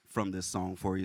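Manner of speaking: ordinary talk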